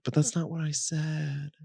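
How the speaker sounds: high, soft, plaintive objection; bewildered protest. Retold or imitated. Man retelling his objection to a mentor, bewildered protest.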